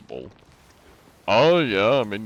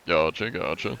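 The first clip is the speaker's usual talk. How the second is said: ordinary delivery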